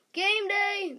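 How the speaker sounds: high, loud stadium call of "Game Day!"; booming announcer shout; announcer yell. Acted. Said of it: "the announcer yells".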